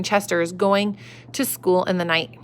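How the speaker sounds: ordinary delivery, nothing marked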